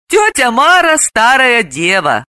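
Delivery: mildly spiteful, drawn out; gloating. A narrator's reading. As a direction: savour the jab. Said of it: "Далила злорадно напомнила".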